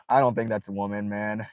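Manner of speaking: ordinary delivery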